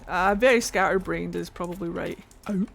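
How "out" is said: Scottish vowel in OUT; Scottish accent. Real, not acted. Streamer slipping into a Scottish accent on OUT. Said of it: "Very Scottish in that out".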